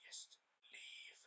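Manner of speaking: a hushed, icy whisper; cold whisper. Retold or imitated. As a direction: whisper it like a cold hero sending her away. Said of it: "I don't know how to do that expression".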